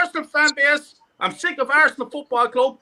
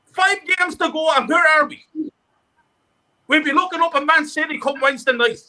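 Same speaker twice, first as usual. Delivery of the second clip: normal talking voice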